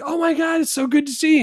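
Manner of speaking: high-pitched, gushing mock greeting; effusive greeting. Guy walks up to hug someone at a party, gushing. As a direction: gush like you've spotted an old friend.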